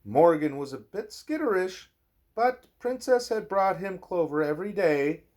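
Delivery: lilting bedtime-story read-aloud; reading to a child. Acted. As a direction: read it gently to a little one at bedtime. Reading a picture book aloud.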